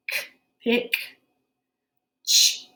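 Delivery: "pick" then a crisp "ch" on its own; teacher's phonics demo; sounding out. Shown as a demonstration. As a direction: isolate the "ch" sound clearly. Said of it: "it sounds like ch".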